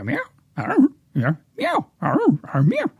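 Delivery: high, meowing cat voice, not getting it; confused cat voice. Retold or imitated. Confused cats at the fire alarm, meowing high. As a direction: meow like a lost, puzzled cat.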